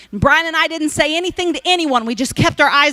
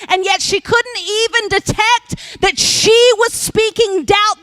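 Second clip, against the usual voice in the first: much higher, slowing, building intensity; rising preaching fervour; 8 semitones higher than usual. Real, not acted. Preacher marvels at the stranger's cruelty, fervent high preaching. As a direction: climb into a fervent preaching pitch.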